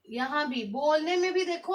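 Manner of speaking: ordinary talk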